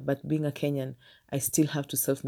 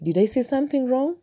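higher, uneasy teacher asking; worried question; 9 semitones higher, 4 dB louder than usual. Retold or imitated. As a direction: ask nervously into the silence. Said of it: "And I was like".

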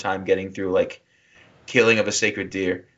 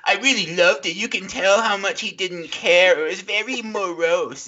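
much higher, mocking voice, laughing through it; impression of Jake; 9 semitones higher than usual. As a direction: squeak out a gushing arthouse review. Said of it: "He totally nailed me".

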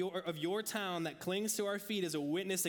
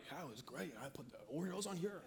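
much softer, laughing reply; friendly stranger; 9 dB quieter than usual. Retold or imitated. Stranger answering the preacher about froyo, soft laughing voice. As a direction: answer lightly through a laugh.

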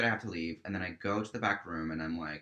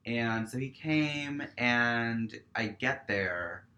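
usual speaking voice

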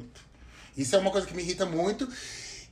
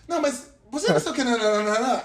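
mock pest voice; 6 semitones higher, 5 dB louder than usual. Mocking an interrupting third person.

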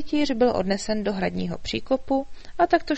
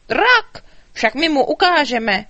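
loud, high battle yell; knights shouting; 6 semitones higher, 9 dB louder, slower than usual. A narrator's reading. Knights shouting threats up at the dragon, loud. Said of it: "Křičeli".

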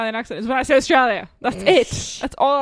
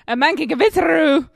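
put-on louder, higher caricature Japanese accent; mock Japanese accent; 4 semitones higher, 7 dB louder than usual. Player gives a hint by overdoing a Japanese accent. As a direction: push the bad Japanese accent hard. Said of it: "I can give you a hint".

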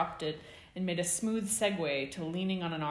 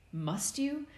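higher-pitched, irritated concern; irritated worry; 10 semitones higher than usual. A narrator's reading. James to Remus picking his scar, irritated worry. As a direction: ask it worried and a little annoyed. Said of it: "irritation lacing his worry".

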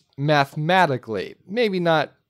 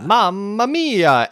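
exclaimed title; 6 semitones higher, 3 dB louder, much slower than usual. Shown as a demonstration. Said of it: "Exclamation mark".

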